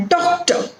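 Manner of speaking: "Doctor" starting high on DOC; stress on first syllable. Shown as a demonstration. Teacher repeating the example for learners, "Doctor" stressed on DOC. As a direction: lean on DOC in "Doctor", drop the second syllable. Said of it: "that is stressed is do".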